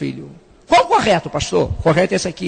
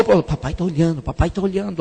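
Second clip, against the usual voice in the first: softer, lower hurried warning; hushed kids; 5 semitones lower, 6 dB quieter than usual. Retold or imitated. Boy in the gallery warns his brother their dad is watching, hushed. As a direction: murmur it so dad won't hear. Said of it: "boys notice father watching".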